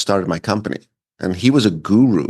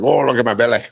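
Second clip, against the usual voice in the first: mock proud guy; 3 semitones higher, faster than usual. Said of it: "they're like".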